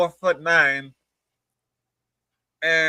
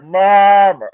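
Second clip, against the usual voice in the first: loud, raised shout of "Mom"; calling for mom; 4 semitones higher, 10 dB louder than usual. Acted. Telling on someone to mom, loud mock holler. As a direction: holler out for your mother.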